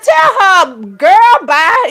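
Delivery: loud, high-pitched retold dismissal; sassy brush-off. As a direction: cut the caller off with loud attitude. Retells dismissing caller through boyfriend.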